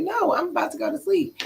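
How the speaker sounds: high, pleading retold refusal. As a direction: lift the voice high to push back.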